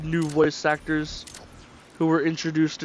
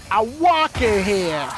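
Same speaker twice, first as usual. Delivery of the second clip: mock New York accent; 4 semitones higher, slower than usual. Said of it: "that stereotypical accent that people do".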